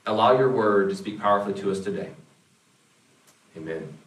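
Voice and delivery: low, earnest prayer voice. Acted. Pastor prays over the congregation, low and earnest.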